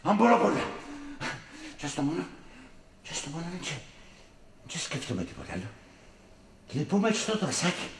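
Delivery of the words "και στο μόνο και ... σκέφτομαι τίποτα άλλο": quiet pained mumble. Acted.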